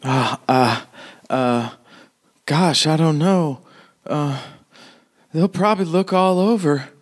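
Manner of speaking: acted flustered concern; concerned townswoman. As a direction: stammer and fret in character. Townswoman concerned about where to hide items.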